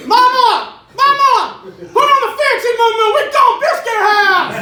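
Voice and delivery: high, loud, excited hillbilly shout; hillbilly family member. Retold or imitated. Hillbilly kid begging Mama to go to Biscuit House, high hillbilly shout. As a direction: holler for Mama in a shrieking hillbilly voice. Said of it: "They were all like".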